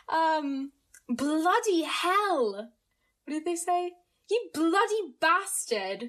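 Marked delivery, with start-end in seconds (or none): 1.1-2.7 slow, higher, hammed-up British curse; attempted British accent. Shown as a demonstration. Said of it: "be, like, British". 4.3-6.0 slow, higher, hammed-up British insult; attempted British accent. Shown as a demonstration. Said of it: "be, like, British".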